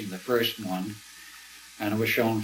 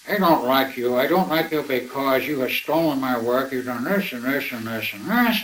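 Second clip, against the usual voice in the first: imitating a drunk caller, a bit louder; drunken William Van Bice; 3 semitones higher, 6 dB louder than usual. Drunk caller accusing Preston over the phone, mock drunken voice. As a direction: play the angry drunk on the phone, lightly. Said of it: "speaking on the phone in a very drunken voice".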